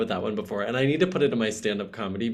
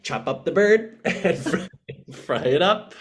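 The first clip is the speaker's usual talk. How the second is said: louder, higher mock chef giving orders; imagined chef; 8 semitones higher, 5 dB louder than usual. Host voicing the singer's chef, hammed-up chef voice. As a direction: play a chef briskly ordering the cooking, laughing midway. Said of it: "her chef is gonna be like".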